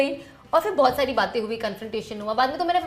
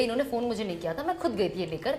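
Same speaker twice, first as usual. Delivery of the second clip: ordinary delivery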